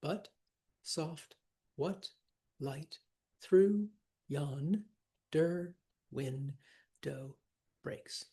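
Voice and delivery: very slow, a gap after every syllable; each syllable separate and evenly spaced; counting the syllables. Shown as a demonstration. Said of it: "Counting the syllables".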